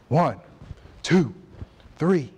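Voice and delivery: counting reps, each number hit hard; loud rep count. Retold or imitated.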